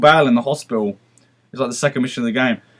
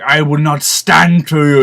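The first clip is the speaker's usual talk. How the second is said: slow, louder mock villain voice; imitated game villain; 5 dB louder, slower than usual. Villain defying the player who stabs him, slow hammed-up declamation. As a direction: declare it grandly, drawing out the words. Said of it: "And he's like".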